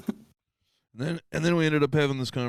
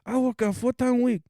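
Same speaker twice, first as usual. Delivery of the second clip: very high Chinese-accent imitation; hammed-up Chinese accent, pitched way up; mock Chinese accent; 14 semitones higher than usual. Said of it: "like, a Chinese accent".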